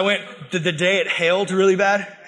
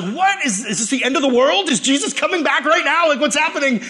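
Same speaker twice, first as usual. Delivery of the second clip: panicked disbelief; 6 semitones higher than usual. Retold or imitated. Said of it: "I'm just like".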